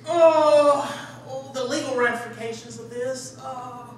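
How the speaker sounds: loud fretful gasp fading to a sigh; worried insurance guy. Retold or imitated. Friend J C from insurance balking at the roof plan, loud fretful gasp. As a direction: fret over liability like an insurance man. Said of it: "would be like".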